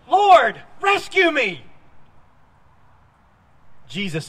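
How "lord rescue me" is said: high, loud cry for rescue; mock shout. Peter sinking, shouting to Lord for rescue, high loud cry. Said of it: "And he shouted".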